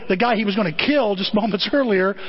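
ordinary talk, nothing marked

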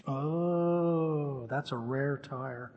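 a lightly acted ominous verdict, slowing down; doubtful store clerk. Store clerk to a customer needing a tire, slow and doubtful.

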